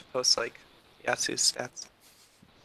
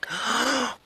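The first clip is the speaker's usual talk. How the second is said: high, soft theatrical gasp; excited gasp; 11 semitones higher, 7 dB quieter than usual. Acted. Princess hearing that a prince is here, excited gasp. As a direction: gasp in delighted surprise.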